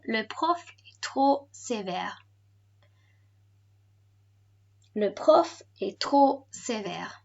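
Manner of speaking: slow, measured "Le prof est trop sévère"; whole-phrase model. Shown as a demonstration. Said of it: "let's try this all together".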